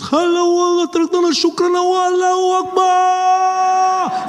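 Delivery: high shouted chant, last word stretched long; hammed-up fanatical yell; frenzied bomber. Bomber losing his head on the plane, frenzied mock shouting.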